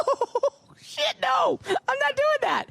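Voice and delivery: high, cracking yelp at the price; shocked yelp. Retold or imitated. To the salesman at the price, a shocked high shout.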